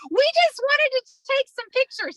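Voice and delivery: high-pitched mocking imitation; pleading tourist. Tourist making excuses to the security guard, mock pleading. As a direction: mimic an innocent excuse way up high.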